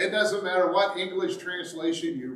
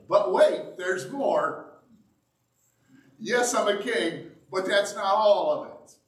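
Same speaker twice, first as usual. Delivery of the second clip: hammed-up "but wait, there's more" pitchman; infomercial pitch; 5 semitones higher, 6 dB louder than usual. Preacher playing an infomercial host for the congregation. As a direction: sell it like a late-night TV ad, louder and higher. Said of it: "almost like an infomercial".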